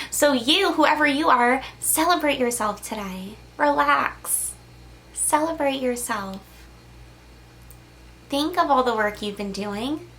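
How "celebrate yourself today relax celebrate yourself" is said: normal talking voice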